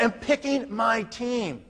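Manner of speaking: very slow, deliberate declaration; confident posture. Shown as a demonstration. Recruiter showing how he talks to prospects, confident posture. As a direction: state it slowly, in charge, no pleading. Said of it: "can you guys hear my posture?".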